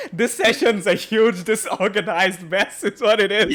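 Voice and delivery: loud, laughing and giddy. Real, not acted. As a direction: laugh through it, loving the chaos. Laughing about chaotic session.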